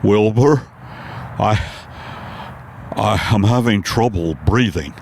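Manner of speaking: a dazed horse struggling to breathe; Mister Ed on acid. Acted. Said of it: "my impersonation of Mister Ed, the talking horse, on acid".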